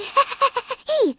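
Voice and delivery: quick burst of laughter; a brief real laugh; short laugh. Real, not acted. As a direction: laugh out at the joke. Reacting to funny game clip.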